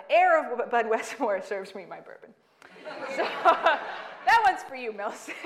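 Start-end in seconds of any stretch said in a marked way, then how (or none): 3.2-5.5 slow, laughing higher and louder. Real, not acted. Dedicating the bourbon joke to Millicent, laughing. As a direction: toast her through a laugh.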